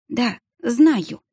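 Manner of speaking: rough, strained voice after crying; hoarse. A narrator's reading. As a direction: croak it out, voice worn from sobbing. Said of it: "прохрипела я".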